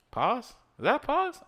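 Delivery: sudden high, baffled question; startled by the pause; surprised. Real, not acted. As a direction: pitch jumps high in disbelief. Reacts to a game pause.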